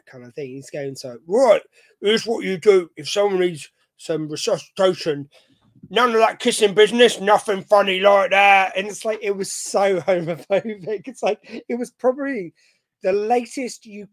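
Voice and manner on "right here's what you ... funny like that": Vinnie Jones geezer. Retold or imitated. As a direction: play the tough-guy ad man, louder, only a hint of the voice. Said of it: "he's basically playing a geezer".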